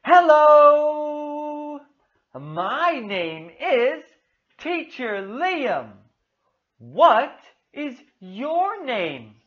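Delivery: slow, exaggerated delivery with drawn-out key words; animated children's teacher. Shown as a demonstration. Teacher praising and prompting little Amanda, animated and slow. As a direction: cheer the child on with big, swooping intonation.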